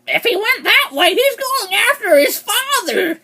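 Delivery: very high, overacted mock cry; hammy high-pitched character impression; put-on actress voice. Reviewer mocking a show's terrible acting, in a very high put-on voice. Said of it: "let me get the voice".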